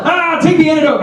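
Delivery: high yelled command, faking madness; mock crazed yell. Comic to the douche, high crazed yell. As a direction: yell it like the crazy lady. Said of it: "I jump up and yell".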